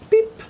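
very high squeaky bleep sound; imitated beep.

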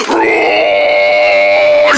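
low breathy dinosaur roar; monster roar. Acted. Dinosaur roaring at the deer, low and loud. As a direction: roar like a giant beast.

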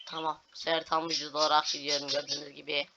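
ordinary talk

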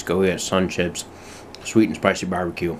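normal talking voice

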